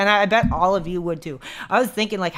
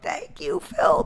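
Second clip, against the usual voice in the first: squeaky thanks; 12 semitones higher, 3 dB quieter, slower than usual. Pretend. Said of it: "I'd be like".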